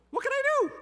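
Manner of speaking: desperate high shout; panicked cry. Retold or imitated. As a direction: cry it out in terror. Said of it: "And what could I do?".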